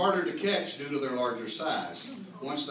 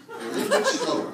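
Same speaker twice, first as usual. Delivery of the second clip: much higher, laughing punchline; 7 dB louder, faster than usual. Real, not acted. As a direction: squeak it out through laughter.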